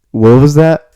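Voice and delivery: puzzled disbelief; baffled question. Retold or imitated. Friend recalls reacting to the bartender in loud surprise.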